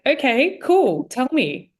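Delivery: eager go-ahead. Retold or imitated. To her friend before the big reveal, high eager okay cool. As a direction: bounce it out, dying to hear.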